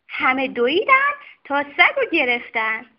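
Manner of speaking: usual speaking voice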